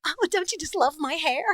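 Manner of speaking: high, gushing doll voice; Barbie. Acted. Toy character admiring her own hair, doll voice. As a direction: show off in a high put-on girly voice. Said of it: "I was also a Barbie".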